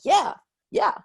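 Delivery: high, bouncy yeah yeah, humoring them; mock eager agreement. Humoring strangers who guess his sport, mock eager. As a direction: play along like you're humoring a guesser.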